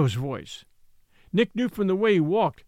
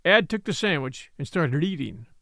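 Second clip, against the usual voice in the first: ordinary delivery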